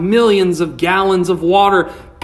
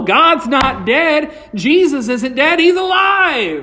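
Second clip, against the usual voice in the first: higher and higher, slow, triumphant declaration; rising preaching; 7 semitones higher than usual. Acted. Preacher rousing the congregation, triumphant. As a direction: build it up to the shout.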